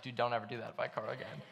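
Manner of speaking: usual speaking voice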